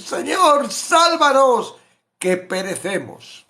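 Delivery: high, loud, very slow plea to be saved; terrified disciples, stretched high wail; panicked cry. Acted. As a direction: cry out to the Lord as the boat sinks.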